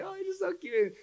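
imitating a gushing grown-up; cooing over a baby. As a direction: coo like an adoring adult. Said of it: "babies are so cute".

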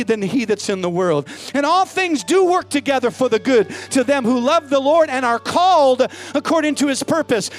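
loud, rising preacher cry over the music; preaching at full force. Acted. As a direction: build it and shout it to the back row. Preacher building to climax.

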